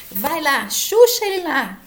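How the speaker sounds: mock the student forcing the remedy; mock pushing. Teacher mocking students who force a remedy, loud.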